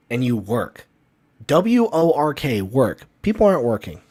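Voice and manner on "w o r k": W O R K spelled letter by letter, slow and punched; spelled out slowly. Shown as a demonstration. To his viewers on hard work, spelling W O R K slowly. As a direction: spell W O R K out slowly to make the point. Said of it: "spells out the word".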